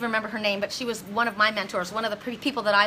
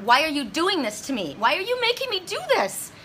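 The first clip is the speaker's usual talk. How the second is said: retold distressed plea; 6 semitones higher than usual. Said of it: "I was crying".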